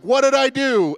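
high, loud outburst of protest; indignant demand. Acted. Job demanding an answer from God, indignant. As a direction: cry it out as the wronged man.

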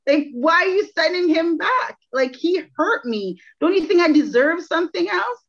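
high, pleading protest; pleading with God. Retold or imitated. Praying to God about taking him back, high protest. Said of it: "And I'm like".

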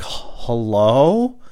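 slow, hesitant, rising hello; nervous hello. Retold or imitated. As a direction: answer like you're scared who's calling. Answering phone cold, nervous.

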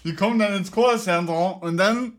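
ranting like a grumpy bigot; mock bigot rant.

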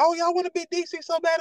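mock the outsiders who claim DC; mocking quote. DMV local mocks DC folks, sneering high. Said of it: "And it's like".

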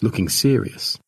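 usual speaking voice

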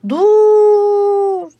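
long drawn-out, high-pitched shouts; stretched high call from a distance. A narrator's reading. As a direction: holler to stop someone far away. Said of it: "diye bağırıyorlar".

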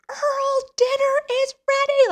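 very high, loud imitated scream. Brother screaming at unresponsive man in man cave, screamed call. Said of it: "So then he screams".

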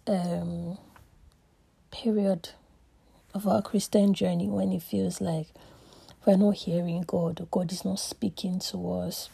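usual speaking voice

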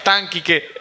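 ordinary delivery

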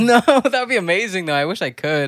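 laughing high and loud; bursting out laughing at the idea; giggly disbelief. Real, not acted. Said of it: "take the microphone in there".

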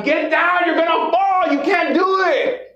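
high-pitched, stretched-out mock yelling; yelling crowd. Onlookers yelling at the frog climbing the tree, high mock yell. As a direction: call it up to someone high above, drawing out the ends. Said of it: "everybody's yelling at him".

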